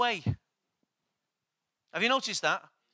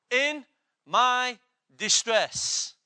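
slow stressed phrase; 2 semitones higher, 5 dB louder, much slower than usual. Shown as a demonstration. Preacher to congregation, slow weighty "In my distress". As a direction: draw out "In my distress" slowly, each word weighted and louder. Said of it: "No, trouble".

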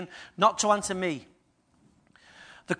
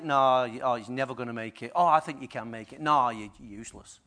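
discouraging voice; 10 semitones lower, 6 dB quieter than usual. Acted. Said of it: "Someone tell you, say".

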